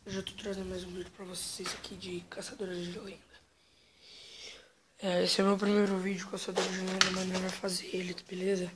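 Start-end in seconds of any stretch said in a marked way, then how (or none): 0.0-3.2 whispering. Real, not acted. Whispered intro to spooky game.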